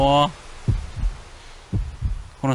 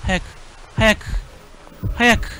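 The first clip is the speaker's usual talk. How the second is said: rising, higher repeated urging; urgent hurry; 7 semitones higher, slower than usual. Real, not acted. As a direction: push faster each time. Rushing the character onward.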